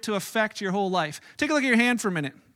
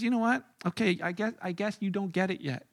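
calm quiet; 7 dB quieter than usual. Retold or imitated. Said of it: "He says".

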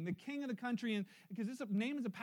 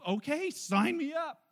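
slow, higher and louder: sign me up; eager sign-up; 6 semitones higher, 7 dB louder, slower than usual. Pretend. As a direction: play the newly convinced listener, drawn out. Imagined listener deciding on baptism.

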